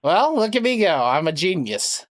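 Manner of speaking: ordinary delivery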